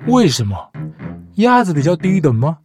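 usual speaking voice

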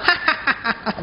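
high, soft staccato ha-ha; put-on happy baby laugh; newborn laughing. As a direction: mimic a baby born giggling. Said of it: "dia keluar-keluar suka".

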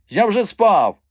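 high, loud indignant dwarf voice; peevish dwarf. A narrator's reading. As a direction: protest in a high, put-on voice. Dwarf complains at being disturbed. (обурений високий голос карлика)

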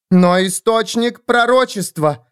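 медленный высокий громкий крик; отчаянно выкрикни протест; закричала. A narrator's reading. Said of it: "закричала Клаудия". (Клаудия кричит о пророчестве, громко и отчаянно)

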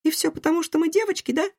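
put-on high, indignant girl voice; protest, offended and high. A narrator's reading. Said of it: "возмутилась Лив".

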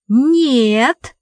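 indignant. A narrator's reading. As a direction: refuse with a burst of indignation. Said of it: "возмутилась Томочка". (возмущённый возглас)

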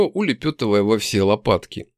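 usual speaking voice

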